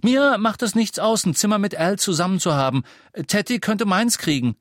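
put-on high, loud, eager boy; enthusiastic. A narrator's reading. Boy offers up his own room, enthusiastic. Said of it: "sagte James begeistert".

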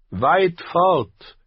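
put-on high, clipped old knight; old knight, curt. A narrator's reading. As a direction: dismiss the question sharply. (alter Ritter, knapp und hoch)

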